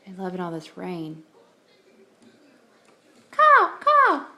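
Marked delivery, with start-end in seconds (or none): 3.3-4.3 high, loud cawing like a crow. Shown as a demonstration. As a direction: caw out like a big crow for the dog. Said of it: "See the crows out there?".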